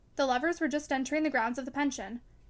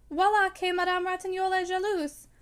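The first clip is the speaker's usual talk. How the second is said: light teasing French, pitched up and louder; boyish laughing jest; 5 semitones higher, 4 dB louder than usual. Acted. Robert teasing Madame Ratignolle about jealousy, laughing lightly. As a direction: tease her with a quick boyish laugh. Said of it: "with a sudden boyish laugh".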